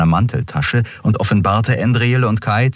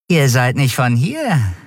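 flute the words like a sly charmer; fluting; 6 semitones higher than usual. A narrator's reading. Drug dealer sizing up strangers, fluting and sly. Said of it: "flötete der Mensch".

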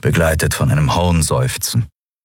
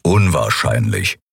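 lower, slow growly reply; gruff grumble; 7 semitones lower than usual. A narrator's reading. Keru grumbles a dry answer, lower and slow. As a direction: rumble it dryly. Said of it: "brummte Keru".

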